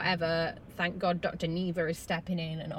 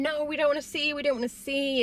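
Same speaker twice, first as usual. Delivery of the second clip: higher, tearful no; imitating crying plea; 7 semitones higher than usual. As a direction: echo their tearful refusal, lightly. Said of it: "Caitlin and Tyler are both crying".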